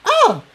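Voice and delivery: sharp high yelp of pain; a sudden cry as the water boils; startled yelp. A narrator's reading. Hurt pony yelps at boiling water, sharp high cry. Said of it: "she yelled".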